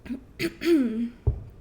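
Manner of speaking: brief throat clear. Real, not acted.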